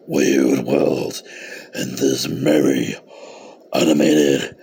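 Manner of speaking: very low, slow creepy growl; deep, croaky Halloween menace; scary voice. Acted. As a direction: draw each word out like a spooky horror host. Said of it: "I'm not gonna do that scary voice".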